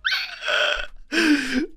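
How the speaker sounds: wheezing, nearly silent laugh rising high; helpless laughter. Real, not acted. Streamer mortified by his terrible accent, wheezing laugh. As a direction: laugh until breath runs out. Failed accent cracks him up.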